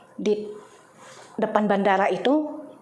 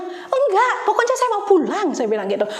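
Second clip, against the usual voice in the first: high, loud refusal then begging; stubborn plea; 10 semitones higher, 3 dB louder than usual. Retold or imitated. Pleading with the clerk.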